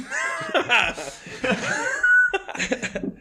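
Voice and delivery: long high laugh at his own punchline; big laugh. Real, not acted. Comic laughs at his own punchline, long laugh. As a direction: burst out laughing at the joke. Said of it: "that's pretty good".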